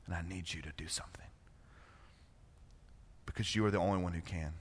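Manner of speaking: fast whispered confession; whispered prayer. Shown as a demonstration. Believer confessing to God, whispered. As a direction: hush it like a private prayer.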